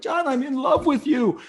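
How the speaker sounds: very high, slow, desperate declaration; tight-throated. Retold or imitated. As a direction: call it out slowly through a tight throat. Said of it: "your throat gets tight".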